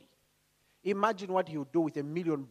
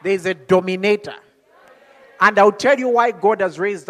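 loud, forceful declaration; emphatic proclamation; 11 dB louder than usual. Real, not acted. Preacher telling the congregation who is in their family, loud proclamation.

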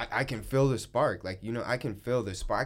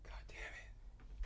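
whispered frustration; whispering; 19 dB quieter, much slower than usual. Real, not acted. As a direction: breathe it out under the sneeze. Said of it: "about to sneeze".

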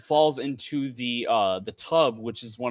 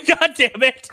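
much higher and louder, cracking up; real laughing curse; laughing exasperation; 12 semitones higher, 8 dB louder than usual. Real, not acted. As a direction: laugh it out in frustration. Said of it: "I like how I'm trying to make it climactic".

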